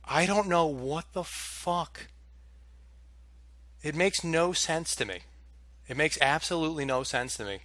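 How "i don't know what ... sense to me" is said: usual speaking voice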